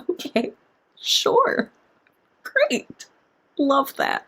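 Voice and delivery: soft, halting mock acceptance; hushed, awkward fake approval with pauses; unconvinced reaction. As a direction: grudgingly accept it in small, quiet bits. Deadpan reaction to the book.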